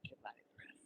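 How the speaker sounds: normal talking voice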